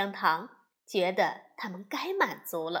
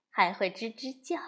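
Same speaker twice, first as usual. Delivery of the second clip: ordinary delivery, nothing marked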